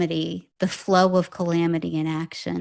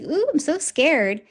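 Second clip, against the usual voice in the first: high-pitched mock fear; sarcastic "ooh", pretending to be scared; 9 semitones higher than usual. Dio taunting the knife-wielding man, sarcastic. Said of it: "he gets really sarcastic".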